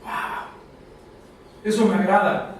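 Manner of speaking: normal talking voice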